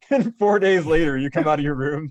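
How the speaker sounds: words bubbling through a laugh. Real, not acted. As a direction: laugh while you say it. Laughing at friend's story.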